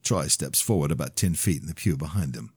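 usual speaking voice

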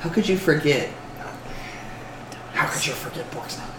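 hushed creature muttering; very soft, whispery reproach; Gollum. Acted. Goblin asked about Bork, Gollum voice. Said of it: "I should do Gollum voice".

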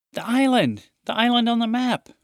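high, loud thrilled exclaim; excited. Acted. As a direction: burst out with the big find. Sister shouts the discovery.

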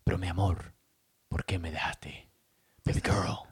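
low, soft, breathy-whispered seductive lover; novela lover voice. Retold or imitated. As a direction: purr it like a soap opera heartthrob. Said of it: "Why does the guy always have to talk like this".

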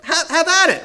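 easy-going dad saying yes; father happily waving the son on; cheerful father. Acted. Father cheerfully grants permission.

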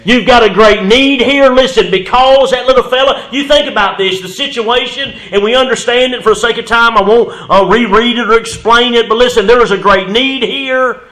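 loud, high preaching at full force; fiery preaching. Real, not acted. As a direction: preach it hard and build the fire. Preacher building to a climax.

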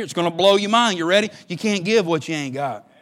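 normal talking voice

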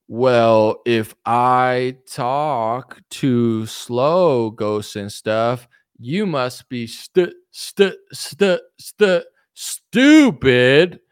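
deliberately crawl through it to tease the commenter; mock extra-slow talk. Answering a chat comment that he talks too slowly, exaggerated slow stutter. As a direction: drag each word out very slowly, stuttering on stu-. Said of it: "You talk too slow".

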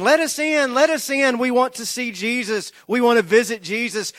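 clamor at the gate to be let in; yelling and screaming. Retold or imitated. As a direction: crowd at the prison gate yelling to get in, high and loud. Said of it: "they're yelling and screaming".

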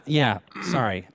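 ordinary talk

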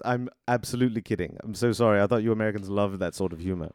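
apologetic English butler; British butler. Acted. Butler excusing his joke to an American, British and apologetic. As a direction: apologise politely in a British accent. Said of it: "he's an English robot butler".

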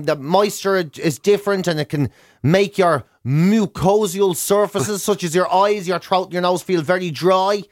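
ordinary delivery

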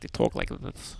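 very low, hushed Midwestern imitation; mock Midwestern talk. New Yorker mocking Midwesterners, hushed Midwestern voice. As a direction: drop it to a whispered Midwestern mumble. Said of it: "they do our voice, and the people in the Midwest".

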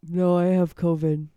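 stuffy, congested sick voice. Real, not acted. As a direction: speak through a blocked nose. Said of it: "Is that why you're talking like that?".

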